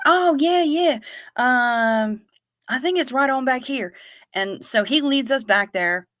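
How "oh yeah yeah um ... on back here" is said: high, loud eager yes; a keen young clerk who just got it; eager kid. Retold or imitated. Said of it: "he's like".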